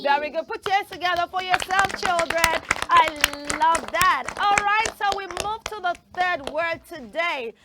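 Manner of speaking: normal talking voice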